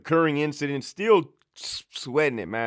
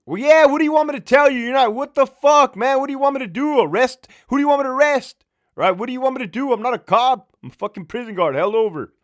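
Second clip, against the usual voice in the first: high, loud, fast rant; furious high-pitched outburst; exasperated yelling; 12 semitones higher, 7 dB louder, faster than usual. Retold or imitated. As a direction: shout it at him, fed up. Ranting at the caller.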